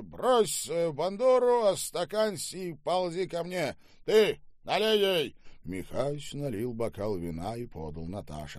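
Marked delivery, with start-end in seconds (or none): 0.0-5.4 loud, high-pitched theatrical declaiming; coarse, bullying Штаубе. Acted. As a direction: hold forth like a boorish drunk master. (грубый, напористый Штаубе, с декламацией)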